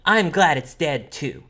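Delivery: emphatic little-sister echo; prompt agreement. Acted. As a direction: chime in promptly as a child. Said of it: "like, promptly".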